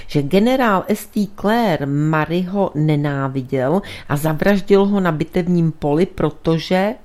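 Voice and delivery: usual speaking voice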